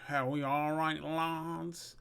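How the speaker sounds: drawn-out character greeting; Tony. Acted. Allotment owner greeting two lads at his gate, put-on voice. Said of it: "And Tony's like".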